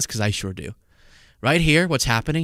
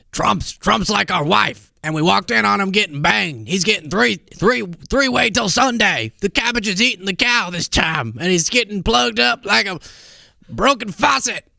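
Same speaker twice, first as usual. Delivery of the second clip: mocking Alex Jones rant; 5 semitones higher than usual. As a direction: ham up a raving radio host. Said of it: "Do you remember the whole".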